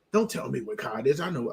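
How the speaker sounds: low, huffy retort; indignant customer. Retold or imitated. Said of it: "She was like".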